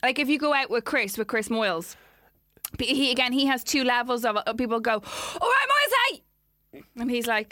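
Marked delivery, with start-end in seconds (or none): 5.4-6.8 high, raised fan heckle; called-out greeting. Retold or imitated. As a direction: holler at a celebrity like a passer-by. People calling out to celebrity.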